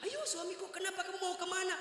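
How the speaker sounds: a high, eerie wife's voice luring him in; sweet high coax from the ghost wife. Retold or imitated. Ghost wife coaxing husband.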